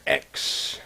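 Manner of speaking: "X" as a breathy, unvoiced throat sound like in Bach; throaty X sound. Shown as a demonstration. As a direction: rasp out "X" from the back of the throat. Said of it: "which makes the H sound".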